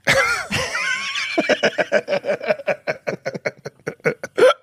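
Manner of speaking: long rising giggle; high laughing squeal. Real, not acted. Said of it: "what sound effect do I have".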